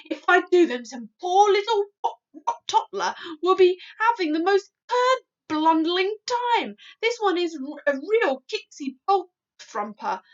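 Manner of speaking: loud high giant ranting; loud agitated giant. A narrator's reading. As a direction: rage about the nightmare. Giant raging about nightmare.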